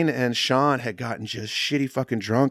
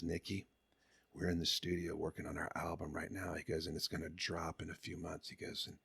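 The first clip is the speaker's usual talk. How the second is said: hushed confiding; 4 semitones lower, 12 dB quieter than usual. Retold or imitated. Mike confiding about album.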